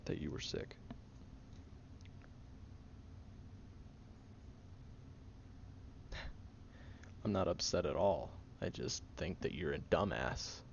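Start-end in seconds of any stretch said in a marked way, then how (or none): 0.8-7.2 quiet breathy chuckle; soft long laugh. Acted. Laughing softly at friend who hid being sick.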